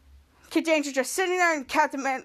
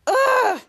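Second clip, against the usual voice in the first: exasperated ugh; 9 semitones higher, 5 dB louder than usual. Acted. Charlotte exasperated at Jasper.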